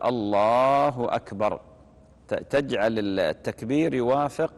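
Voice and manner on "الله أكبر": slow, full "الله أكبر"; demonstrated takbir. Shown as a demonstration. Imam demonstrating the takbir with raised hands. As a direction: call "الله أكبر" as if opening prayer. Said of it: "تقول".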